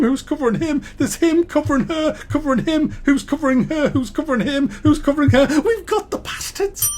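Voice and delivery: spiral from a muttered plot into manic triumph; scheming developers. Retold or imitated. Reviewer mocking the developers plotting enemy cover, building from muttered to frantic. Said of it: "That's the kind of voices".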